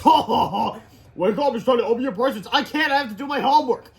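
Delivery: loud, high, hammed-up comic voices; exaggerated Christmas comic voice; acted holiday scene. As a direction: play it big like a cartoon. Christmas laugh, then acted kids' lines.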